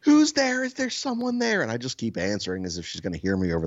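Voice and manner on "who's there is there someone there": loud, very high imitation of a yelling woman; retold yelling. Frightened wife upstairs challenging an intruder, high mock yell. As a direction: yell it out high, mimicking an alarmed homeowner. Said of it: "she just keeps yelling".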